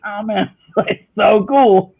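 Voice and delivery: high, drawn-out awe; impressed, swooning re-enactment; retold delight. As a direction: gush slowly, won over. Retold change to delighted reaction.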